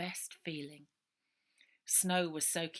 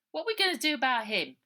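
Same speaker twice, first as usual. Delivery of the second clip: worried; 8 semitones higher, 9 dB louder, faster than usual. A narrator's reading. Worried question about William.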